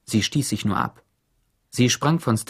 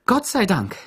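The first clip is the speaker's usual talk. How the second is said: much higher, exaggerated relief; relieved exclamation; 11 semitones higher, 3 dB louder than usual. A narrator's reading. Warden cries out as Stanley climbs free, higher feigned relief. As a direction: fake a rush of relief. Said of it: "rief die Chefin aus".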